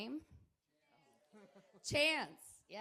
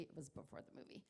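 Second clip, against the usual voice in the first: whispered aside; 8 semitones lower, 11 dB quieter, faster than usual. Real, not acted. Quiet aside confirming the timeline.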